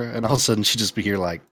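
ordinary delivery

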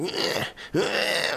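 high, squeezed straining squeaks; mock someone straining through exercises; strained high grunts of effort; imitated exertion grunts. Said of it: "let's talk in a normal human voice again".

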